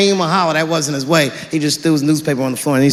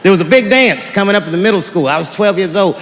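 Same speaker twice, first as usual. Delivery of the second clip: normal talking voice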